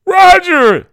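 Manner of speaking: very high, loud scream of a name; horrified yell. A narrator's reading. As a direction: yell for your son in horror. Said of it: "she yelled in horror".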